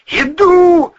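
loud, very high hiss; hissed reply. A narrator's reading. Volynov answering the call with pistol out, hissing. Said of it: "зашипел Волынов".

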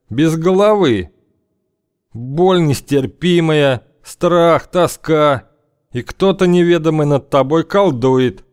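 high, slow, ominous storyteller; grim old gang leader. Acted. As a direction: warn of horrors gravely.

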